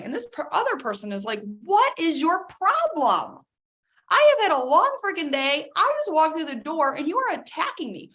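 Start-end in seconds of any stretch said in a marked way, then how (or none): 1.6-8.1 high, loud exasperated snap; higher, louder fed-up retort; exasperated outburst. Retold or imitated. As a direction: fire back after a long day. Other spouse snaps back.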